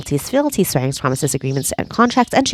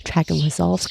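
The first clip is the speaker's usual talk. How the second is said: ordinary talk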